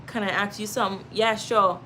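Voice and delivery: ordinary talk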